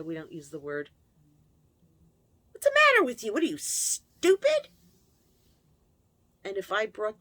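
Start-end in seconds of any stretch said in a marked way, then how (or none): 2.5-4.7 loud, high, contemptuous shout; berating mother. Retold or imitated. A mother shouting at her daughter over a paper bag, loud and contemptuous. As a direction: yell it down at the child.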